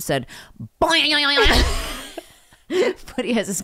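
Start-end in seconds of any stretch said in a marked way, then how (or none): 0.8-1.1 high, loud cartoon "Boing"; springy sound effect. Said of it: "leave a comment that went".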